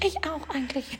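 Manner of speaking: soft, meek little voice; mock timid. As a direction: murmur it shyly. Imitating shy neighbour. (leise und schüchtern nachgeahmt)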